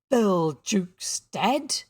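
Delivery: slow, loud, disbelieving cry; startled cry. A narrator's reading. Pirates learn of their shipmate's death, startled. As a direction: draw each word out in shock. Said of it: "cried the startled pirates".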